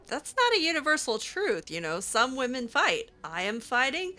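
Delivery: ordinary talk, nothing marked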